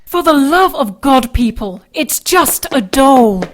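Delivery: loud, slowing exasperated yell; exasperated shout. Acted. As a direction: yell at them, fed up.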